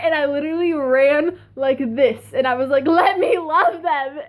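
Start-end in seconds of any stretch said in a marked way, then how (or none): 2.9-4.2 slow, higher, mock-desperate cry; hammed-up fangirl plea. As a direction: draw it out like an overwhelmed fan. Retold run to the band.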